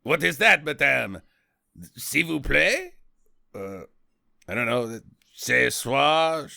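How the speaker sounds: a hammed-up French official, fumbling; mock French accent. French official answering the American widow, mock French accent. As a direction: fake French accent, lightly done. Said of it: "And they're like".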